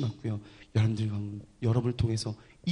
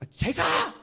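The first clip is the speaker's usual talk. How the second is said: shouted vow. Retold or imitated. Soldier crying out his vow before everyone, fervent. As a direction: proclaim the promise with all your strength. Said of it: "이렇게 고백합니다".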